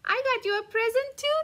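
ordinary delivery, nothing marked

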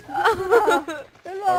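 high, loud urgent call; shouting. Real, not acted. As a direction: yell for the team to come. Urgent fight, calling teammates.

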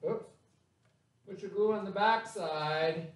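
ordinary talk, nothing marked

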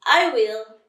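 high, loud, nervous outburst. Acted. Lion promising the animals to act, nervous. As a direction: blurt it out nervously. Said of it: "said Lion nervously".

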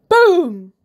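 big loud boom. A narrator's reading. Balls burst out.

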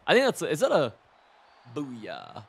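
ordinary talk, nothing marked

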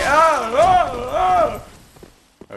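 repeated high, loud yelps; panicked screams. Real, not acted. Player spotted while sneaking, panicked high screams. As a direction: scream in short bursts.

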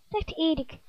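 usual speaking voice

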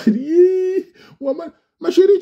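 high mocking voice to a crying girl; mocking taunt. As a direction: tease her like a schoolyard bully.

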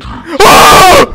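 loud high scream of fright. Real, not acted. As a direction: scream at the scare. Touches object, scare.

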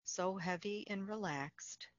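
calm, unhurried meditation voice with long pauses; guided meditation. Acted. Facilitator guiding listeners through Yoga Nidra, calm guided meditation.

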